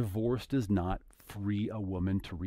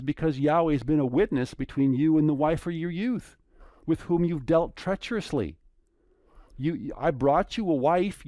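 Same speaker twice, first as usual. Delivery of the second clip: louder, raised preaching voice; fervent preaching; 4 semitones higher, 4 dB louder than usual. Acted. Preacher warning the congregation about divorce, fervent and loud.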